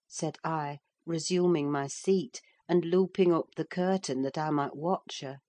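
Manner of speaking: ordinary delivery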